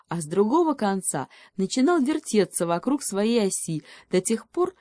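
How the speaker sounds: ordinary delivery, nothing marked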